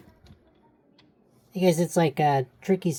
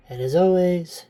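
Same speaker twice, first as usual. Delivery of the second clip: breathless, winded sign-off; out of breath; 5 semitones higher, 6 dB louder than usual. Real, not acted. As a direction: say it short of air. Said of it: "sorry, I'm a little out of breath".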